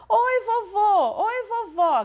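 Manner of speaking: high, loud, drawn-out greeting; excited shout. A narrator's reading. The daughter shouts hello to her arriving grandparents, high and excited. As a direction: call out happily across the yard. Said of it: "gritou a filha dos Costa".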